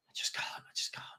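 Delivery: breathed I just can't with no voice; whisper. Real, not acted. Fed-up host muttering to himself, whispered. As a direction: drop to a defeated whisper.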